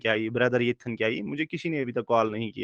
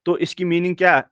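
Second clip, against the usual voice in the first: ordinary talk, nothing marked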